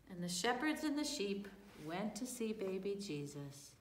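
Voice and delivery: ordinary delivery, nothing marked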